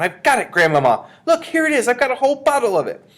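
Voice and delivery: put-on high, loud, triumphant child; jubilant, breakneck-keen announcement; excited cry. A narrator's reading. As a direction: shout it bouncing with joy. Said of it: "jumping up and down".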